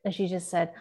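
normal talking voice

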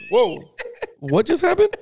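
stunned reaction climbing high; mock astonishment. Playing a stunned parent watching a kid misbehave, high-pitched surprise. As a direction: act baffled, pitch rising sharply at the end.

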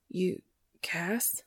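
breathless whisper, barely voiced. A narrator's reading. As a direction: whisper it in disbelief. Tearful reunion in a store.

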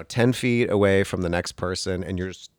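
ordinary talk, nothing marked

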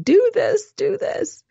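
very high, mock-bossy squeal; bossing people around. Producer bossing everyone around on the film, mock bossy. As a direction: order everyone about in a high put-on voice. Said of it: "bossing everyone around saying".

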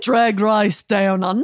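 slow, cartoonish Australian accent; exaggerated Australian, like a cartoon goose. Shown as a demonstration. Co-host demonstrates their best Australian accent, as a cartoon goose. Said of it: "my best Australian accent is, uh, c-, cartoon goose".

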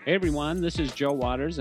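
normal talking voice

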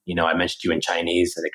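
ordinary talk, nothing marked

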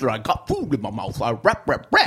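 a hint of a mumbling rapper. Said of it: "The guy who talked like this".

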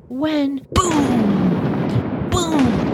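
mock thunder claps; thunder booms. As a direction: boom it out like a drum by her ear. Thunder waking her, imitated.

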